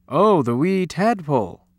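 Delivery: a hint of a Scottish uncle; lightly voiced uncle. Uncle on the phone about the tadpole, lightly voiced. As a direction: lightly play a fond storybook uncle.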